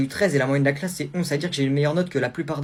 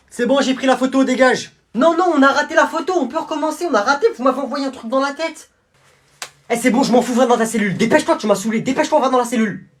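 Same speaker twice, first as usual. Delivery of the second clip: loud, higher impatient guard; annoyed guard; 10 semitones higher, 8 dB louder than usual. Acted. Guard sending the prisoner back to the cell. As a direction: shoo the prisoner away.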